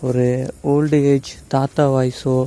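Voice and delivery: ordinary delivery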